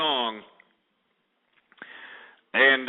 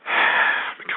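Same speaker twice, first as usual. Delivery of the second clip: heavy sigh. Real, not acted. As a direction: blow the anger out. Forcing himself to calm down.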